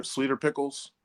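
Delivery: normal talking voice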